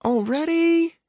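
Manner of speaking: put-on high, drawn-out child's whine; whining. A narrator's reading. Girl whines to her father about leaving for school. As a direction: whine it like a kid who doesn't want to go. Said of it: "she whined".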